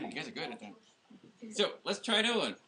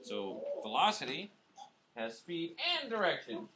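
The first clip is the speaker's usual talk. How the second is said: ordinary talk, nothing marked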